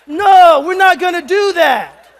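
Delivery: ordinary talk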